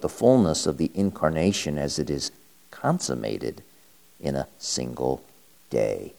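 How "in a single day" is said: usual speaking voice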